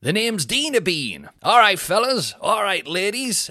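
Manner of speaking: loud, high, drawn-out stage greeting; imitation of a hyped-up club comic taking the stage; comedian's grand entrance. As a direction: belt out an over-confident showman's hello. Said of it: "this is how Dino Bean envisioned starting his set".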